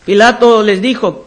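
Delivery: ordinary delivery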